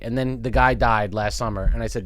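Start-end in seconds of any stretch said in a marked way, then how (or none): none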